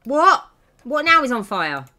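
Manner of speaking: loud, high disbelieving outburst; exasperated. Real, not acted. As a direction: exclaim at yet another disaster. Another fire in the game.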